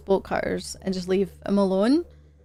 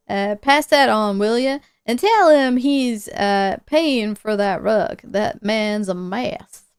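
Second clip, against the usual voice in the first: folksy put-on drawl, mildly irritated; hammy country homeowner voice; evasive homeowner; 4 dB louder, slower than usual. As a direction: play a testy man brushing off a visitor. Voices Red with accent.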